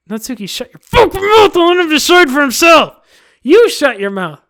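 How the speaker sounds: furious shout. Acted. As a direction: scream her down. Yuri screaming at Natsuki.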